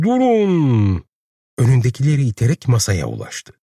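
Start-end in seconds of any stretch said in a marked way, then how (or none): 0.0-1.0 high, drawn-out call to halt; loud ringing cry. A narrator's reading. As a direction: call out over a crowd. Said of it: "diye bağırdı".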